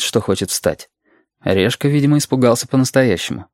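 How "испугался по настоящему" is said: ordinary delivery, nothing marked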